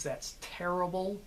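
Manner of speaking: hard American syllables on "terrible"; direct, specific American pronunciation; American accent. Shown as a demonstration. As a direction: pronounce each syllable directly, American style. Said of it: "the pronunciation of my syllables is".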